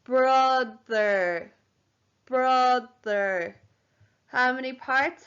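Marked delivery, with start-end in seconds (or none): 0.0-1.5 BROTHER stretched into two long syllables; said slowly. Shown as a demonstration. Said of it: "Say the word slowly". 2.2-3.6 BROTHER drawn out, two long beats; said slowly. Shown as a demonstration. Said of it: "Say the word slowly".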